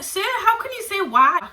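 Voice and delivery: high, loud outraged reply; indignant protest. Retold or imitated. Retelling talking back to Mister Crowther, high outraged protest. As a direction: push back at the teacher in disbelief. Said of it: "I was like".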